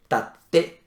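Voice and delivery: long consonant gap in tate; pause inside the word. Shown as a demonstration. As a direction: stop briefly before the te of tate. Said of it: "hay una pausa".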